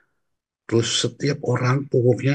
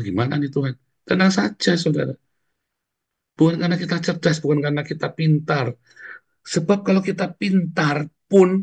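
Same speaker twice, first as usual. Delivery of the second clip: fervent preaching; 6 semitones higher than usual. Acted. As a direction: exhort the congregation with rising passion. Preacher exhorts the congregation. (khotbah berapi-api dengan suara tinggi)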